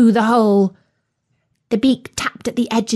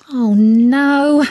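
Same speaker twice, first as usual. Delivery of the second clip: higher, mildly dismayed "oh no"; 4 semitones higher, 5 dB louder than usual. Acted. Dog fretting over the turkey's fate, dismayed. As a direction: let a touch of alarm lift it. Future Dog realises trouble is coming.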